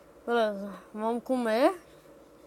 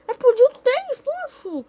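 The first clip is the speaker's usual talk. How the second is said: shocked; 12 semitones higher, 7 dB louder, slower than usual. Real, not acted. Creepers exploded.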